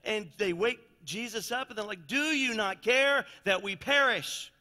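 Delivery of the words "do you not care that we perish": loud, slow, desperate plea over the storm; panicked cry. Retold or imitated. Disciples in the storm waking Jesus, panicked loud plea. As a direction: cry out like sailors about to drown.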